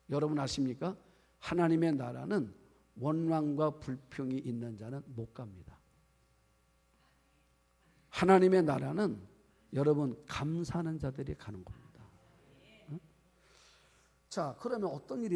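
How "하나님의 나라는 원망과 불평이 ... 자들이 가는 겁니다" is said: normal talking voice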